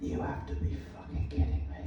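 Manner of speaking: very soft, fast, voiceless mutter; quick whispered aside to yourself; muttered to self. A narrator's reading. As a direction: mutter it under your breath in disbelief. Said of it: "I muttered to myself".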